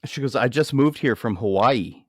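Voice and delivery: ordinary talk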